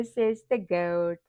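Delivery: ordinary talk, nothing marked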